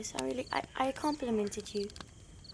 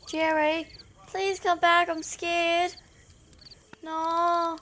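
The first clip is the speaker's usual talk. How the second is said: scared calling; 4 semitones higher, 7 dB louder, slower than usual. Real, not acted. Left alone, scared, calling.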